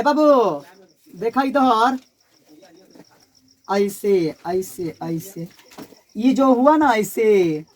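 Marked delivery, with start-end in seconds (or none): 3.7-5.5 murmured. Real, not acted. Drawing on the board, murmuring.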